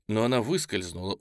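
ordinary delivery, nothing marked

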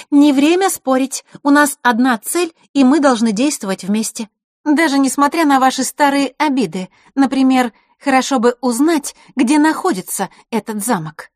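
put-on high, loud girl's appeal. A narrator's reading. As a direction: raise the voice to rally everyone. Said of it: "и добавила громче".

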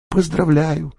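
dry ironic congratulation, pitched high. A narrator's reading. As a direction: congratulate with mock sincerity. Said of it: "иронически сказал Халфин". (иронично)

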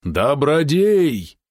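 high, very drawn-out shout; loud call. A narrator's reading. Father shouts for his son from shore, loud call. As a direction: stretch the name out as it rises. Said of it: "теперь он прозвучал очень громко".

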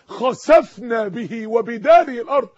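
slow and loud Quran quote; thundering verse. Real, not acted. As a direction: boom the verse down on them. Recites Quran verse on Qarun.

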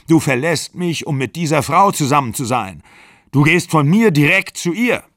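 acted breakup fury; angry accusation. A narrator's reading.

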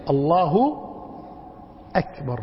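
slow الله, pause, then أكبر on a clean glottal stop; realised hamza. Shown as a demonstration. Teacher corrects the mistake, slow and hamza sounded. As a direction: set أكبر apart with a distinct hamza. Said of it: "حقق الهمزة".

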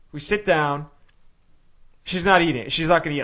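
ordinary delivery

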